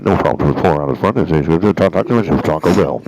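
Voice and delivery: loud, fast drive-thru speaker voice; like a crackly drive-thru intercom. Retold or imitated. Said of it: "It's kinda what it sounds like".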